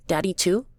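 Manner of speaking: put-on high, questioning child voice; high-pitched rising child's question; small boy. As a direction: ask like a hopeful little kid. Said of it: "Chrissy would say".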